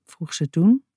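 ordinary delivery

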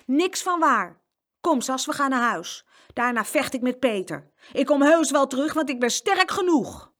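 high, indignant denial rising to loud brag; defiant boasting. A narrator's reading. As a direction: protest hotly, end loudest. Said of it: "Jeroens opschepperij".

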